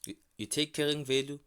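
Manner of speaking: normal talking voice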